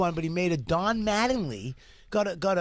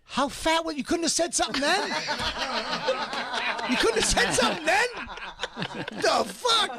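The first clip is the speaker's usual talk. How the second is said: high, laughing through the words; laughing disbelief; 9 semitones higher than usual. Real, not acted. Host laughing at his old footage, high laughing disbelief. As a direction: laugh while scolding.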